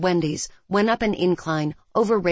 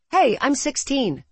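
eager; 7 semitones higher than usual. Acted. Teen asks to drive, eager.

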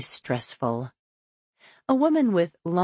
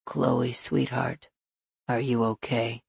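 gentle; 5 semitones lower than usual. Acted. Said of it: "gently squeezes".

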